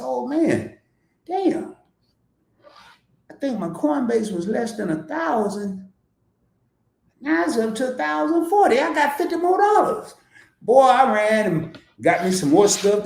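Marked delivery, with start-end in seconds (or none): 0.0-10.2 high, thrilled reading of his balance; excited self-quote. Retold or imitated. Retelling checking his stocks, high and thrilled. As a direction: squeal over the extra money. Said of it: "And I say".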